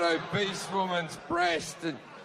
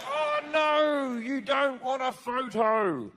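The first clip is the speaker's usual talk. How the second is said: mock disappointment; 5 semitones higher, slower than usual. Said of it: "And I'll be like".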